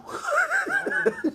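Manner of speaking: real burst of laughter; laughing. Real, not acted. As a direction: break out laughing mid-talk. Laughs about being invited.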